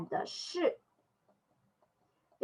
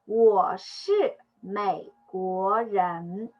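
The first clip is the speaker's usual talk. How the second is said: slow model reading; much slower than usual. Shown as a demonstration. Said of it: "when we read this, just like".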